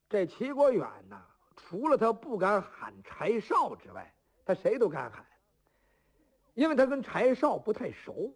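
plain reading voice

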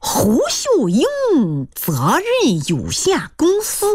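slow, high, solemn announcement of a company name; mock-serious. Suitor answering mother's question about his company, mock-serious. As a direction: draw out each word with put-on gravity. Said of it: "他一脸正经地说".

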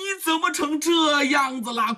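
high, startled cry of dismay; shocked outcry. Acted. A man shocked at his friend's state, crying out. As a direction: burst out in alarm at the sight.

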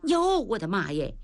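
plain reading voice

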